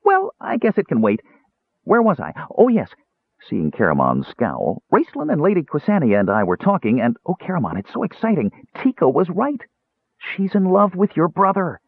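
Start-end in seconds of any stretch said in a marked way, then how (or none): 0.0-2.9 high-pitched, distracted little character; flighty kender. A narrator's reading. Flighty kender loses his thread. 4.9-11.8 high-pitched, breathless-with-news kender; excited gossip. A narrator's reading.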